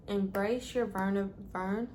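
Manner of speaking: ordinary talk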